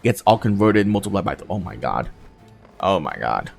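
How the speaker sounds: ordinary talk, nothing marked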